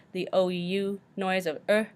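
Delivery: ordinary delivery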